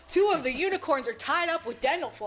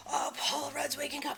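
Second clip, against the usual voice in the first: ordinary delivery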